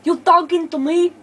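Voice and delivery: tough-guy movie line, lightly hammed; mock Arnold voice. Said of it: "Why do I sound like Arnold Schwarzenegger?".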